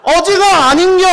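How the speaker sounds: high, loud, indignant market auntie; squealing disbelief at the squid seller; shouted Gyeongsang auntie. Acted. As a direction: protest like a baffled shopper. Gyeongsang woman argues, confused.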